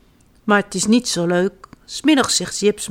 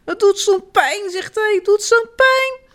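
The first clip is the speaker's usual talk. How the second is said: whiny crying; 12 semitones higher than usual. Acted. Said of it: "moet Jip al te huilen".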